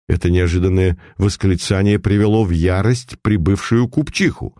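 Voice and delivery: ordinary delivery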